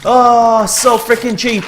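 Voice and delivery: loud, high, dragged-out grievance; exasperated complaint. Real, not acted. Gamer calling the game cheap, exasperated. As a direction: groan it loudly and slowly.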